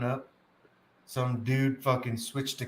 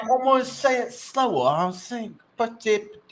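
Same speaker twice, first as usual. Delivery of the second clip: higher, garbled mock Scottish; lightly voiced Scottish mumble; mock Scottish accent; 9 semitones higher than usual. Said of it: "But he's like".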